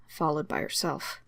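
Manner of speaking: usual speaking voice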